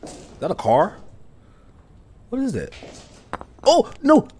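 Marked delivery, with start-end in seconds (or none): 2.3-4.3 sudden high, loud panicked cry; frightened shriek. Real, not acted. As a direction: yelp in real fear. Monster appears in horror game.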